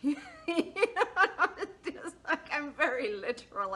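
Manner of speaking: laughing through the words; giggling as she admits it. Real, not acted. Amused narrator laughing at herself.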